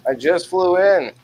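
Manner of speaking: put-on loud, high old-time stand-up comic; old borscht belt comic. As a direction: belt it out like a hammy vaudeville comic. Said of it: "I'd come up and be like".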